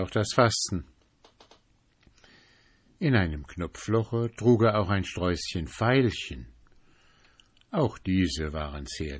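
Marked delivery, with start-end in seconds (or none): none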